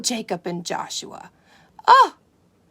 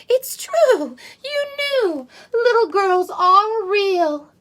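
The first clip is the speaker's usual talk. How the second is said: much higher, squealing with joy; excited unicorns; 10 semitones higher, 10 dB louder, much slower than usual. Acted. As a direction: cheer it like thrilled little unicorns. Unicorns cheer in discovery.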